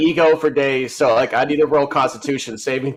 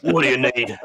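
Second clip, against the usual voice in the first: default aggressive. Acted. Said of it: "everything I do is default aggressive".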